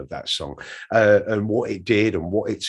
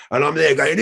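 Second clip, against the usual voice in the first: ordinary talk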